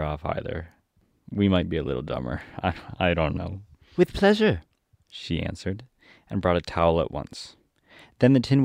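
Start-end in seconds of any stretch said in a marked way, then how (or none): 4.0-4.6 put-on high girlish Dorothy voice, obliging; Dorothy in a willing mood. Dorothy agrees to wipe the Woodman's tears, high willing girl.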